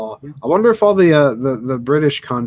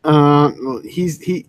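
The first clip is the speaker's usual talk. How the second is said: ordinary talk, nothing marked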